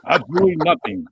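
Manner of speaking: lightly voiced movie villain; Spaceballs impression. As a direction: quote it like the film scene. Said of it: "the scene from Spaceballs".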